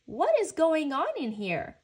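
high, loud parental question; loud, urgent exclamation; alarmed demand. A narrator's reading. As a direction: demand to know, startled. Father runs in after the crash.

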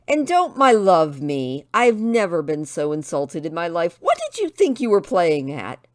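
loud, offended telling-off; indignant scolding. Acted. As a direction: lay into him angrily. Insulted woman berates Tristan.

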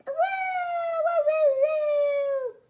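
long, loud, high-pitched howl; wolf howl. A narrator's reading. Wolf howling at Theodore outside his cave, loud high howl. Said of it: "It howled at Theodore".

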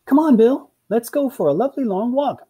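high, bright coaxing call; high-pitched enthusiasm; eager owner. Acted. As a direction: urge the dog out in a high, cheery voice. Owner cheerily urges dog out.

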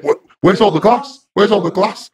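high, loud, urgent repeated question; alarmed demand. Retold or imitated. Staff member finding the glass gone, alarmed.

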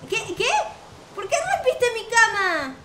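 indignant surprise. Real, not acted. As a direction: protest in disbelief. Zombie broke her bed.

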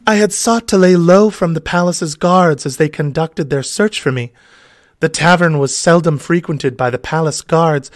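high, breathy-free damsel voice. Acted.

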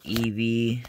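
ordinary talk, nothing marked

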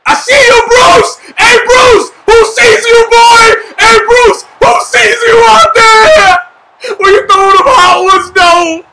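taunting shout. Real, not acted. Calling out Bruce across the game.

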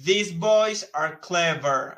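slow, careful English with a put-on voice; slow model sentence. Shown as a demonstration. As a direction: model it word by word. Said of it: "Y luego para acá decimos".

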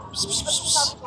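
high, hushed pis-pis to lure a cat; cat call. Real, not acted. Scene: woman coaxing a stray cat to come eat, soft high call.

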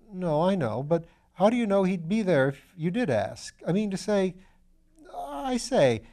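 lightly voiced nervous young chap; eager young man. A narrator's reading. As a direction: gush like a keen, naive student. Young Piggott's excited chatter.

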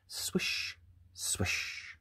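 soft whispered swish. A narrator's reading. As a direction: whisper it like a brush stroke. Sound of hair brushing.

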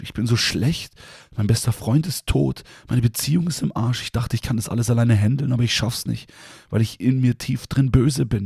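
high, pleading outpouring of guilt; anguished confession. Retold or imitated. A young man confesses his sins to God, anguished and pleading. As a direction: pour out every sin as if you can't stop.

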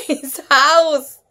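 usual speaking voice

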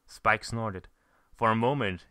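usual speaking voice

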